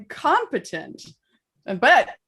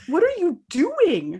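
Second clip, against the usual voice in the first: higher, slow angry question; mock exasperated at friends; mad; 8 semitones higher, slower than usual. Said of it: "I was so mad. I was like".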